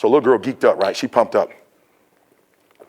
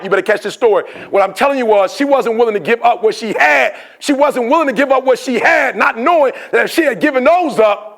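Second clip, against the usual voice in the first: high, driving motivational sermon; urgent, raised exhortation at full stretch; full-force preaching; 10 semitones higher than usual. Real, not acted. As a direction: preach it hard to the crowd.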